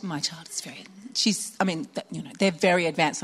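hushed whisper of a proud mum; imitating a mum whispering her bragging; mock confiding boast. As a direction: whisper it like a secret brag.

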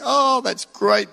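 slow, drawn-out broad Australian vowels; lightly hammed-up Aussie drawl; slow, high broad Aussie drawl; broad Aussie accent. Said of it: "that broad, ridiculous accent".

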